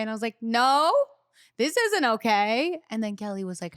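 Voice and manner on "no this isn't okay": protest. Retold or imitated. Protesting to [name].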